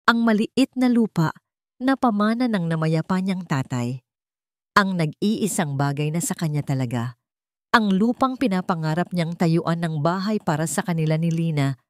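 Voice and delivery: usual speaking voice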